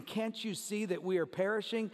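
normal talking voice

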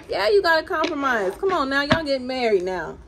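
loud, teasing mock exhortation; playful mock scolding. Tarot reader ribs a couple about marriage, playful scolding. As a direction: play at telling a couple off, loudly.

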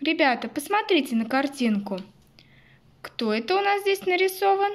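put-on quick bright question to little kids; fast, lively kindergarten teacher voice; talking to toddlers. Asks children about picture. (как с малышами в садике)

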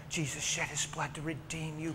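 normal talking voice

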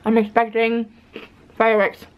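talking with mouth partly full; chewing. Real, not acted. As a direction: between bites of a cookie. Seen 0: Bites cookie at -2; pursed, chewing-like mouth through about 3.4 s.

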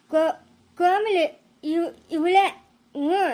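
slow stutter on the name; halting, with a questioning grunt at the end; stammering. Acted. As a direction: struggle to get each word out. Stammering man insists she is Komli.

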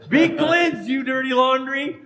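hammed-up miracle worker; mock healing command. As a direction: command the laundry with mock authority.

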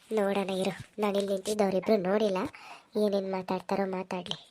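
low, soft muttered aside; eavesdropper's aside. Acted. Hidden listener watching two people talk, in a soft aside. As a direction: murmur to yourself while listening in.

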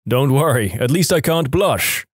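plain reading voice

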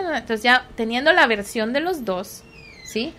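ordinary delivery

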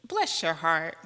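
lightly voiced Southern drawl, mock sweet and quiet with a laugh; a hint of Southern sarcasm, softly; Southern "bless your heart". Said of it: "That's the Southern way of saying".

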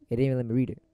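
ordinary talk

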